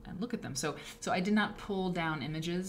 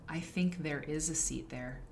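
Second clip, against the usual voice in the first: normal talking voice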